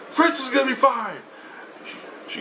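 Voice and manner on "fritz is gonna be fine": high-pitched imitation of a wife; mock wife brushing off the worry; insistent wife. Wife answers husband about the dog, high insistence. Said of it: "She goes".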